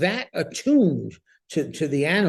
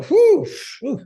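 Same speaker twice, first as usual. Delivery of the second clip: mock winded puffing, high and loud; gasping for air after a long run; exhausted panting; 5 dB louder than usual. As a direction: puff like you just finished the run.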